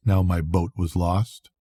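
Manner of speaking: ordinary delivery, nothing marked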